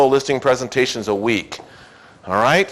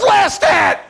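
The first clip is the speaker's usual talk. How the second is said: much higher, loud mock shout; emphatic punchline; 4 dB louder than usual. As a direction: fire the answer out like a punchline.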